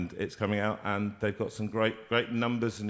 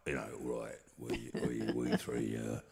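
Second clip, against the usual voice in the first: low, mock Cockney king voice; Cockney accent; 6 semitones lower than usual. Hammed-up Cockney king for the radio host. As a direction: talk it like a cheeky Cockney. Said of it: "Cockney one".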